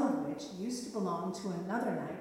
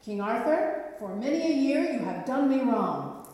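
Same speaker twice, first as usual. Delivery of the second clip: loud, higher accusation of the king; angry knight; 4 semitones higher, 7 dB louder than usual. Acted. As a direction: confront the king you hate.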